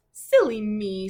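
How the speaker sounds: a pig laughing at his own forgetfulness; silly me. Acted. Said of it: "says Preston".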